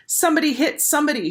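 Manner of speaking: fast, high excited shout; girl shouting to urge a fistfight. A narrator's reading. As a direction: egg them on to fight. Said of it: "shouted Laurie".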